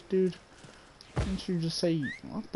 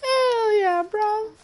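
very high, loud celebratory squeal; falsetto cheer; 6 dB louder, much slower than usual. Pretend. Player celebrating his own accent, high falsetto cheer.

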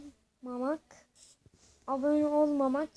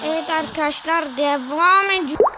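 louder hammed-up host call; loud announcement; 11 dB louder than usual. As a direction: belt out the restart to viewers.

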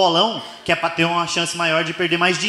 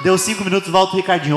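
ordinary delivery

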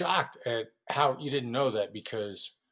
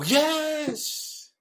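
"Yes" leaps much higher and louder; eager yes; 5 dB louder than usual. Shown as a demonstration. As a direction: hear "Yes" as a triumphant win. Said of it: "I hear you go".

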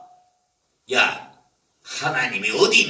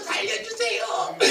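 much higher, comic plea to be spared; mock cry for help; 8 dB quieter than usual. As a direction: squeal for mercy. Beggar being beaten.

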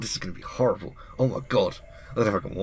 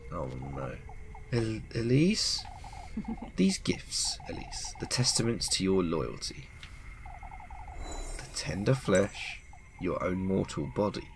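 softer, whispered witch voice listing the gifts; a hushed menacing witch; 8 dB quieter, slower than usual. Acted. Witch gloating over the girl's offerings, hushed. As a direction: breathe it out like a slow threat.

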